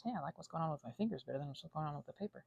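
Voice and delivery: ordinary talk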